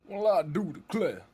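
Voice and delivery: hammed-up Southern US gentleman; old southern gentleman. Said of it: "talk like an old southern slave owner".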